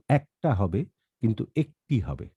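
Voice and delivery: একটা with open "æk", একটি with closed "ek"; vowel contrast. Shown as a demonstration. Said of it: "এ এর উচ্চারণেও আমাদেরকে যথেষ্ট সতর্কতা". (একটা-তে "অ্যাক", একটি-তে "এক" উচ্চারণ)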